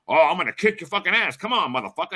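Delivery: mock-furious taunt; mad threat. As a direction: imitate a mad guy picking a fight. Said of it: "He got real fucking mad".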